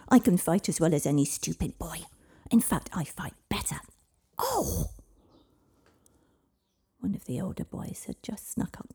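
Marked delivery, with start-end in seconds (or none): 0.1-3.8 put-on loud defiant child shouting; angry girl. Angry girl confronting boys. 4.4-4.9 startled cry. Acted. Girl pushed from behind.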